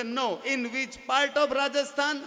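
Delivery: slow, drawn-out mock English; mock tourist. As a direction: drawl it like a curious foreigner. Imitating tourist.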